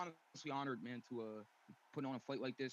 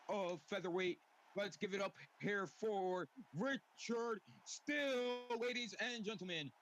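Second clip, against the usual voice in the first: boxing ring announcer; 3 semitones higher than usual. Acted. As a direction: build to a big hype finish. Ring announcer introducing red corner.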